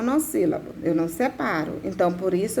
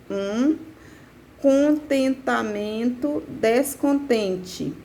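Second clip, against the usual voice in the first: "um contentamento descontente" at half speed, each syllable measured; "con-ten-ta-men-to des-con-ten-te", slow and deliberate; syllable-by-syllable scansion; 2 semitones higher, much slower than usual. Shown as a demonstration. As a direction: count through "contentamento descontente" beat by beat. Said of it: "aí eu conto".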